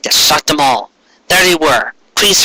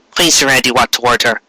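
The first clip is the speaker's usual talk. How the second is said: ordinary delivery, nothing marked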